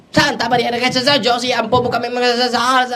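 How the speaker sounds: high, loud strained possessed voice; possessed woman. Retold or imitated. Priest voicing a possessed woman mocking the prayer, high and loud. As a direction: cry out like someone possessed.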